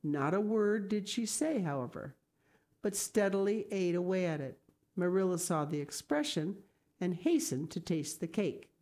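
ordinary delivery, nothing marked

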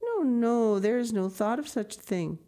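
reassuring lady. Acted. Grandmama promising the grandmother they will return, reassuring. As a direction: brush away her fears warmly.

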